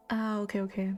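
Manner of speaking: usual speaking voice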